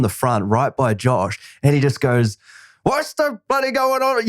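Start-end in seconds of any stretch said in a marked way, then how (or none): 2.8-4.3 put-on high, slow blurted line from a confused old man; blurting old extra. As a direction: blurt it out like a lost old actor.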